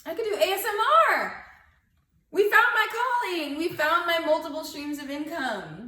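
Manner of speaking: high, loud excited exclamation. Real, not acted. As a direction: cheer it out, laughing. Excited, laughing realization.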